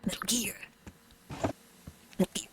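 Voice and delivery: hushed whisper. Real, not acted. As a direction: whisper it under your breath. Hiding from the monster.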